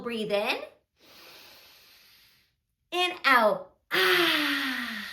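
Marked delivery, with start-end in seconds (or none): 3.9-5.1 long, forceful open-mouthed exhale; dragon breath. Shown as a demonstration. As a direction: breathe out like a dragon spreading fire. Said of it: "like you're spreading fire with your dragon breath".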